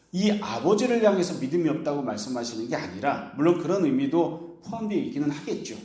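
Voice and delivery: ordinary talk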